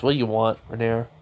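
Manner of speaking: usual speaking voice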